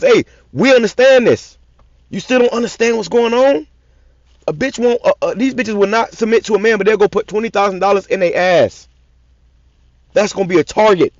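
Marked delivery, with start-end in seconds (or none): 0.0-8.9 raised, higher, exasperated voice; frustrated. Real, not acted. Preacher venting frustration at his audience, exasperated and high. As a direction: let the frustration push the pitch up. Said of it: "You can hear the frustration in my voice".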